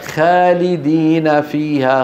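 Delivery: خالدين with a thin, fronted kha; thin Kha error. Shown as a demonstration. Teacher showing students the mistake, light خ. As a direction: leave the خ of خالدين unthickened, slowly. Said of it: "عدم تفخيمها".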